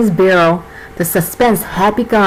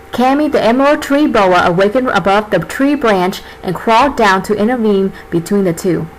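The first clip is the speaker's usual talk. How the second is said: usual speaking voice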